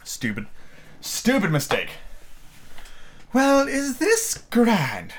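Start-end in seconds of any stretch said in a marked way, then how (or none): 3.3-5.1 put-on high, slow, wondering girl's voice; Alice voice. A narrator's reading. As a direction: savour each word like a girl in awe.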